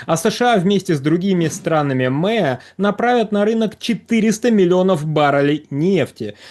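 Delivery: ordinary delivery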